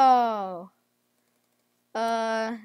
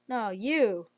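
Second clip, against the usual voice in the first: usual speaking voice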